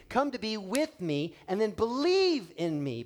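normal talking voice

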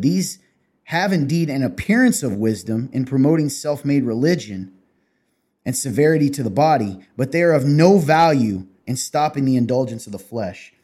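loud, emphatic Bible reading; scripture reading. Real, not acted. Podcast host reads Colossians to his co-host, emphatic scripture reading.

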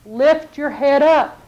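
slow, drawn-out plea; slow urging. Acted. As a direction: lift them slowly with the words. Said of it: "God's speaking to you".

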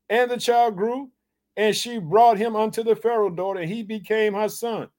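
lifted, measured Bible reading; scripture reading. Acted. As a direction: read the passage again with weight. Preacher rereads the scripture.

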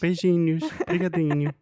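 high, loud mock Portuguese farewell; mock Portuguese accent. Husband closing his Portuguese impression. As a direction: chirp Beijinhos and Obrigadinho like someone from Portugal.

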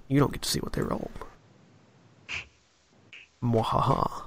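low, hushed gloating GM. Pretend. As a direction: tease the players like a villain. GM teases hidden enemy rolls.

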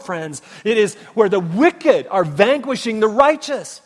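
loud, fast, high-pitched preaching; full-force preaching. Acted. Pastor thundering to his congregation, full-force preaching. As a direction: drive the sermon home at full volume.

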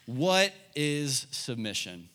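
What is submission asked slowly, each word weighted; deliberate teacher's question; slow, emphatic question. Shown as a demonstration. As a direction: spell it out slowly for note-takers. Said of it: "write this down".

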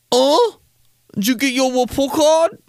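put-on high-pitched taunt with babyish R's; mock a child who can't say R's; mocking baby talk. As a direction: tease in a high little-kid voice. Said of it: "like a kid who can't say his R's".

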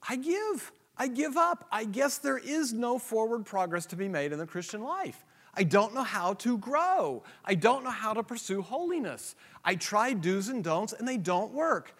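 high-pitched, whiny giving up; despairing believer. Retold or imitated. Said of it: "and you go".

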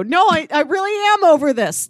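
normal talking voice

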